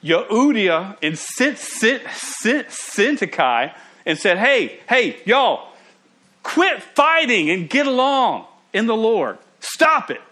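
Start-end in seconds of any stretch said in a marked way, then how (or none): 4.4-10.2 raised, higher-pitched scolding call. Acted. Paul calling out two quarrelling church women by name, scolding call. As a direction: call them out like squabbling kids. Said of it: "Called out".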